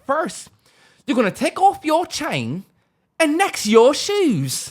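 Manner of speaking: high-pitched mock British gangster; mock British accent. Gangster robbing a visitor in Britain, mock British accent. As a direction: put on a squeaky British accent, stretching the end. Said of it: "talking in a British accent".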